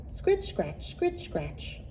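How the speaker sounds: ordinary delivery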